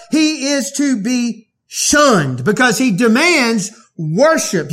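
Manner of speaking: forceful preaching. Acted. Preacher thundering to his congregation about worshiping Jesus, loud and emphatic. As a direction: drive each claim home to the hearers.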